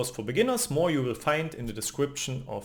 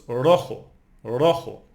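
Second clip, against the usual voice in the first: "rojo" punched on RO, louder; second-last syllable stress; 2 semitones lower, 5 dB louder than usual. Shown as a demonstration. Teacher applying the rule for learners, "rojo" stressed on RO. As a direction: hit RO in "rojo", let the end drop. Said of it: "emphasize the second last syllable".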